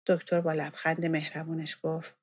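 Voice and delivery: plain reading voice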